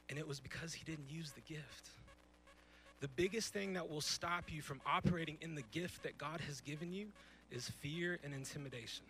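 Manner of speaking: usual speaking voice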